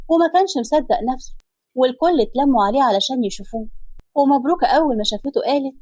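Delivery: usual speaking voice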